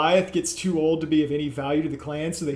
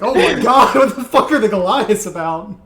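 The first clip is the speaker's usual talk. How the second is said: loud, raised-pitch disbelief; mock shock; 5 semitones higher, 9 dB louder than usual. Player imagining the party watching the beating, mock-shocked. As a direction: blurt it out, appalled and amused.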